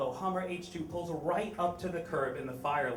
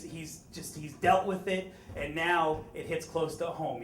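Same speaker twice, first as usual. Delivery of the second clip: ordinary talk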